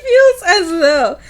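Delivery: reading through giggles; laughing. Real, not acted. Host losing it over the chapter recap, giggling. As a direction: try to read on while laughing.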